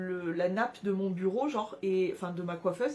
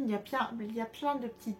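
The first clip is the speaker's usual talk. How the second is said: ordinary delivery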